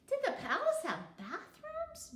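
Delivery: put-on very high little-girl question; a small kid wondering aloud; curious child. A narrator's reading. Child Beanie asks.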